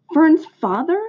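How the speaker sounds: high, dismayed little-pig question; lightly acted tearful disbelief; sobbed question. A narrator's reading. Pig hears Fern's father is in the plot, mildly sobbed. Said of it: "sobbed Wilbur".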